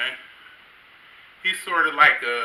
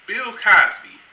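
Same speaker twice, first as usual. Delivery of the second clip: proud answer; 7 semitones higher, 8 dB louder, slower than usual. Retold or imitated. Dad proudly names comedian.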